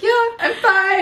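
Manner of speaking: high, loud mock nonchalance; imitated breezy cover-up; playing it off. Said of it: "at playing things off".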